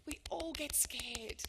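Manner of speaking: slow, breathless whisper with stress on all. Shown as a demonstration. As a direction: whisper it like a shared secret. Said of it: "repeated, then whispered".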